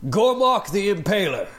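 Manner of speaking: building, rising announcer call; arena announcer. Acted. Announcer introduces Gormok the Impaler.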